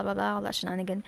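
usual speaking voice

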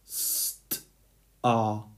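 St-ar pulled apart into st and ar, softly; split into sounds. Shown as a demonstration. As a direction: stretch St-ar into its two sounds. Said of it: "So let's break it up into two parts".

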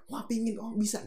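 high-pitched mock eagerness; light, high awestruck reaction; wowed listener. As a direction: imitate someone suddenly inspired. Acting an impressed listener.

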